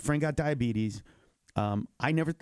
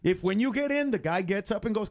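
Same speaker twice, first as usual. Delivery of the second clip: normal talking voice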